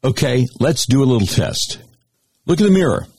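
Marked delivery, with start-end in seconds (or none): none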